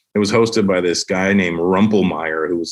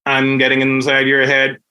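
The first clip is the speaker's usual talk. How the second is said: eerie catchphrase; 3 semitones higher than usual. Retold or imitated. Imitating Wild Will catchphrase.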